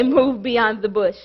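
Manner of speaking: a low, set-apart voice of God giving the charge; God speaking. Acted. God giving the preacher his sermon title. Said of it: "He said for me to say".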